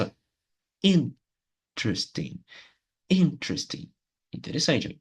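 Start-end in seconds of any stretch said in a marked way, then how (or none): 0.8-3.9 "Interesting" with IN bursting out, then falling away; explosive first syllable. Shown as a demonstration. Teacher showing where the emphasis goes, "Interesting" exploding at the start. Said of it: "ela vai explodir ali no começo".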